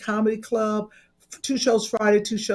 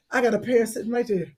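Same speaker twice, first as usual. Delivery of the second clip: ordinary talk, nothing marked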